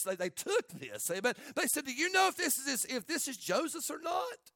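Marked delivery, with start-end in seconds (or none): 1.9-4.5 high-pitched innocent question, lifting at the end; feigned innocence. Acted. Brothers asking Jacob about the coat, feigned innocence. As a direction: play dumb in a high, questioning voice. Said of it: "How deceptive".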